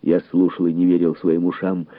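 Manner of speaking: usual speaking voice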